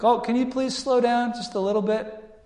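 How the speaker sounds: high, put-on voice, playfully bossy; teasing Mordecai. Mordecai teasing Haman from the horse, high mock voice. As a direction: tease the man leading your horse. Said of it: "Mordecai being like".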